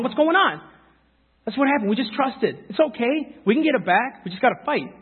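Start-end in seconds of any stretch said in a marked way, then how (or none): 0.0-0.6 high-pitched disbelieving question; shocked reaction. Acted. Preacher replaying the response to seeing something wrong, shocked. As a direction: blurt it out in acted alarm. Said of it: "your first initial response to something".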